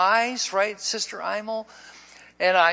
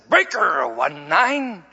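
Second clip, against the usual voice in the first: slow, drawn-out trucker radio hail; lightly voiced CB breaker call; 2 semitones lower, 3 dB louder, much slower than usual. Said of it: "but everybody else said it".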